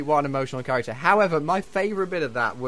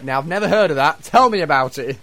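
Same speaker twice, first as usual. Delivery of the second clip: hammed-up dismissive judge, louder; mock judge, louder; 8 dB louder than usual. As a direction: play the clueless judge for laughs, louder. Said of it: "Tulissa went".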